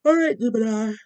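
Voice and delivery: talking through a yawn; sleepy, mid-yawn speech. Real, not acted. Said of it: "I don't know why I'm yawning so much".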